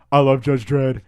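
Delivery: loud, high fanboy outburst; starstruck blurt. Retold or imitated. Fan answering Jock's casual hello, starstruck blurt. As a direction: blurt it out too loud and eager.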